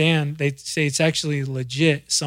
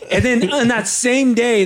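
normal talking voice

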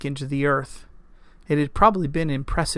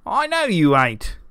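put-on higher-pitched toothless old farmer; sputtering old farmer; 4 semitones higher, 4 dB louder than usual. A narrator's reading. Old farmer contradicting Lancelot, sputtering through missing teeth. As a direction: contradict him in an old rustic's voice. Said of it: "the old farmer sputtered through missing teeth".

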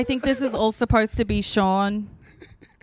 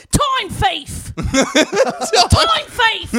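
much higher, louder mock UK accent yell; 11 semitones higher, 6 dB louder, much slower than usual. [name] yelling at a slow restaurant in a mock UK accent. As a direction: cry it out like a cartoon Brit. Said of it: "it's just that accent".

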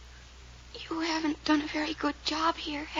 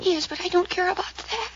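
usual speaking voice

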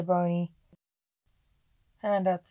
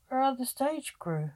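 higher-pitched puzzled question; 7 semitones higher than usual. A narrator's reading. Zack asks about the missing stage crew, puzzled. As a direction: ask it with mild bewilderment.

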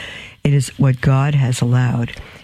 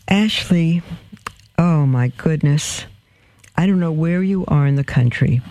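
usual speaking voice

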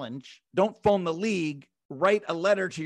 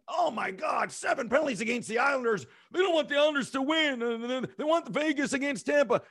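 high, fast mock fan outrage; a fan complaining that the league is against them, in a mild mock shout; a hint of a whining fan, quick and raised; mock outraged fan; 6 semitones higher than usual. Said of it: "There's people who really believe".

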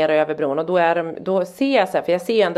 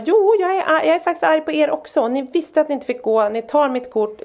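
mildly indignant, raised pitch; retold anger; 10 semitones higher than usual. Mum scolding son and his friends, mild retold anger. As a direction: imitate an insistent telling-off. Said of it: "och så var jag arg".

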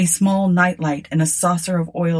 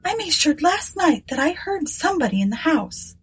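higher, timid younger sister voice; 10 semitones higher than usual. A narrator's reading. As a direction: voice Eunice as a nervous woman. Eunice voiced.

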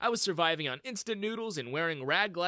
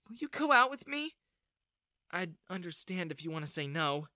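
shy confession; 7 semitones higher than usual. A narrator's reading.

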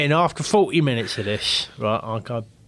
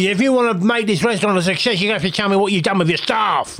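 lightly imitated TV-chef tirade, raised pitch; Ramsey; 7 semitones higher, 4 dB louder, faster than usual. Customer demanding the truth about staff, mock chef tirade. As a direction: push it like a chef taking charge. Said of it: "I went all Ramsey on him".

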